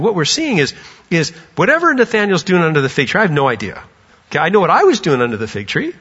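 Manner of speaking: ordinary talk, nothing marked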